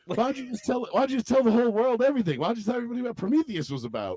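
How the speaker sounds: high, fast cartoon rant; mock outrage. Cartoon character berating another over spoilers, mock outrage.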